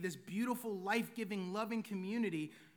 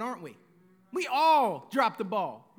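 normal talking voice

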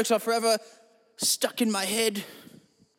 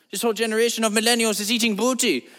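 usual speaking voice

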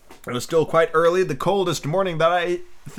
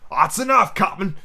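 lightly shouted, higher pitirate voice; cheery shout; 5 semitones higher than usual. A narrator's reading. Long John answering the captain, mild cheery shout. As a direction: call it back cheerily, pitched up. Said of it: "shouted Long John cheerily".

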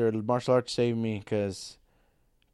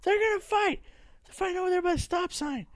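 put-on high squealing kid voice; excited kid. Kid telling everyone a fight is starting, high and squealing.

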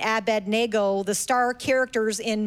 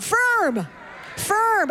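loud; 8 semitones higher than usual. Shown as a demonstration. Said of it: "Can everybody say that loud?".

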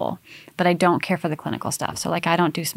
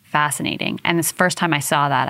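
ordinary talk, nothing marked

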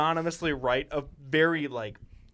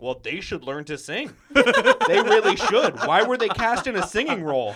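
louder, slow, laughing through the words; mocking a tone-deaf cast member to a friend, laughing louder; laughing retort; 6 semitones higher, 11 dB louder, slower than usual. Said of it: "I'm like".

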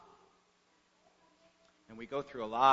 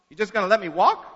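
louder, higher disbelieving question; incredulous boy; 5 semitones higher, 7 dB louder, faster than usual. A narrator's reading. Puzzled boy to the principal about his punishment, incredulous. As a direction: ask it in puzzled disbelief, pitch jumping up.

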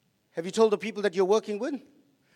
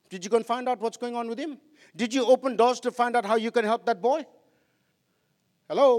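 ordinary talk, nothing marked

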